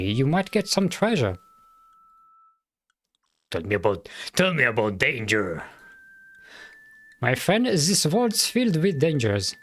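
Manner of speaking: put-on the sheriff in a mild German accent. Sheriff telling the adventurer about the town, light German accent. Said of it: "me trying to do a German accent".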